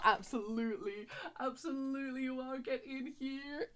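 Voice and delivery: hushed self-answer. Acted. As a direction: murmur your own reply softly. Quiet answer to own question.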